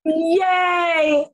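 loud, high cheer of joy; excited cheer. Real, not acted. Guest cheering for Michelle's nomination, loud and high. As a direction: cheer out loud for her friend.

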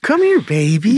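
high put-on coaxing voice; parrot impression. Acting out parrot video.

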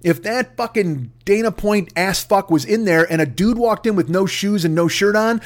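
ordinary talk

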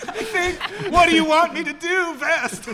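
high, loud frantic yelling; panicked shouting. Pretend. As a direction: yell in a total panic on the trading floor. Panicking trader on the stock floor.

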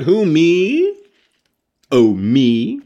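loud, high-pitched mock-startled Clyde; startled exclamation. A narrator's reading. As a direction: exclaim it loudly, hamming up the flustered question. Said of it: "exclaimed Clyde".

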